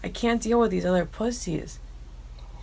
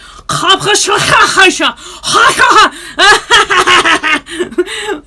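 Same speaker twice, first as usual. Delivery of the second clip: high, loud cackling laugh; big laughing fit; 13 semitones higher, 14 dB louder than usual. Real, not acted. Cracking up at Philly and Israeli bluntness, loud shrieking laugh. Laughing at her own story.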